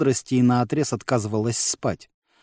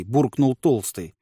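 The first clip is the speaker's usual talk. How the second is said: ordinary delivery, nothing marked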